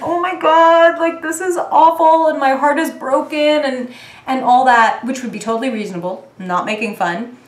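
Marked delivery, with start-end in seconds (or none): 0.0-3.4 high, loud hammed-up despair; mock heartbreak. Mocking last year's heartbroken self, hammed up. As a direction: overplay the heartbreak. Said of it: "it would have been like".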